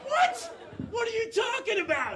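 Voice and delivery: very high, squeaky incredulity; high-pitched baffled outburst; shocked disbelief. Acted. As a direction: react appalled, voice shooting way up. Shocked nephew reacts to uncle.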